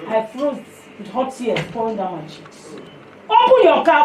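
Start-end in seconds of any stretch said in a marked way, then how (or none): 3.3-4.1 loud, high burst of an order; retold command. Preacher retelling an urgent order to open the car, commanding.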